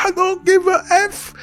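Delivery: hammed-up dismissive snarl; mock furious reply. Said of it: "He said".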